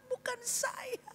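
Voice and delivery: small, high mock whimper; slow, soft, high-pitched fake crying; crying denial. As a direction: softly wail like a weeping child. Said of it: "Dia nangis".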